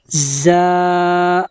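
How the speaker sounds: "زا" pressed through closed teeth; teeth together. Shown as a demonstration. Teacher demonstrating to students: a pressed "زا". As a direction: clench the teeth and push "زا". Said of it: "دونوں دانتوں کو ملا کر زور دیا جائے گا".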